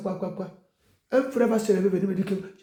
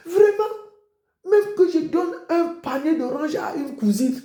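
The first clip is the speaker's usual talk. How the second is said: high, slow, earnest brother's voice; emphatic brother; 8 semitones higher, 4 dB louder than usual. Retold or imitated. Preacher playing the brother, high and drawn out. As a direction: stretch it out, high and insistent.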